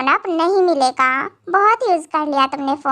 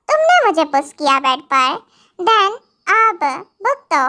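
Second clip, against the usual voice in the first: ordinary talk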